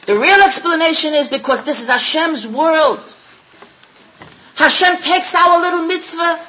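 rising, loud preacher's build; preaching. Acted. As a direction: drive the point home to the congregation. Preaching at full force.

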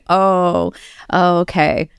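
ordinary talk, nothing marked

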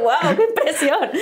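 awestruck, with a laugh; laughing retold wonder; amazed. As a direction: starstruck gasp. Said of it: "Fue así de".

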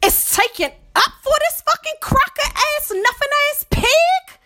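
shrill-pitched disgust at the police; outraged high rant. Real, not acted. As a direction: spit it out, voice cracking high. Furious insult at police.

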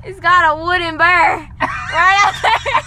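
put-on Southern accent. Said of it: "Southern accent".

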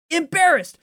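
Embarrassed alone, high and loud, falling; embarrassed repeated. Real, not acted. Fan repeating the word in disgust, loud. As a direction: spit out Embarrassed again. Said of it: "and we get embarrassed".

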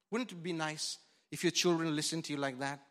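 ordinary talk

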